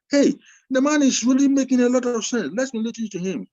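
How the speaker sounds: high, loud, eager exclamation; impressed listener. Retold or imitated. Imagined audience member suddenly excited by the speaker. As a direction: burst out like a convinced listener.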